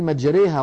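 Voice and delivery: مجريها with the alef bent fully to e, mejreha; with imala. Shown as a demonstration. Teacher contrasting imala on مجريها with the open مرساها. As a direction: bend the vowel of مجريها to ee. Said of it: "أما أن يميل".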